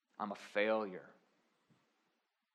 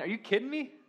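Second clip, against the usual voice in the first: incredulous outburst; 13 semitones higher, 7 dB louder than usual. Acted.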